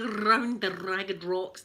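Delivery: slow "Round the ragged rocks", Rs trilled; rolled Rs. Shown as a demonstration. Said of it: "we like to roll with R's".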